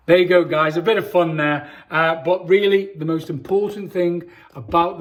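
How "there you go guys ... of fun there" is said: usual speaking voice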